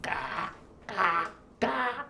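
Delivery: put-on baby crow caw. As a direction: peep like a newborn crow chick. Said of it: "새끼 까마귀 흉내를 내는 거야". (아기 까마귀 울음 흉내)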